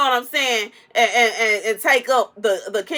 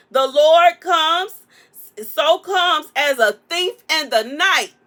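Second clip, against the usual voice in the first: slow, loud sermon voice; preaching; 3 semitones higher, 4 dB louder, slower than usual. Acted. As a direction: proclaim it like a preacher. Quoting scripture dramatically.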